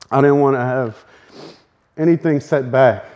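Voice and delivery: ordinary talk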